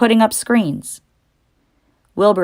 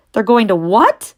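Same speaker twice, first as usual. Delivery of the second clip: screamed in shock; 5 semitones higher, 5 dB louder than usual. Acted. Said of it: "screamed Wilbur".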